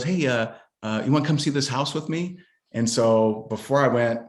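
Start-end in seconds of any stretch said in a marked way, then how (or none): 0.0-2.4 higher, fast coaxing wife voice; wife sweetly inviting, quick; imitating wife. Wife voiced in funny story.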